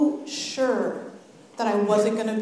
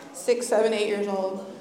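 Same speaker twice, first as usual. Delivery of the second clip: ordinary talk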